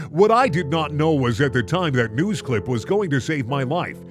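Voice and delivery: usual speaking voice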